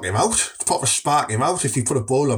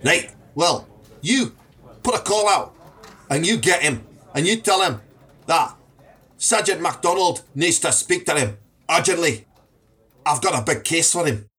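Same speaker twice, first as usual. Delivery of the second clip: demanding police sergeant; 2 semitones higher than usual. Acted.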